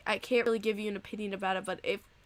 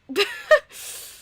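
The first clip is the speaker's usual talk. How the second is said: very high, teary laugh at her own confession; guilty high laugh; 6 dB louder than usual. Real, not acted. Streamer laughing off a terrible trade idea, high and guilty.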